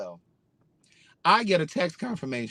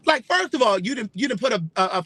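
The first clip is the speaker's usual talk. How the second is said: offended retort; 6 semitones higher than usual. Real, not acted. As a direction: bristle at the insult. Offended by the shoeshiner's reply.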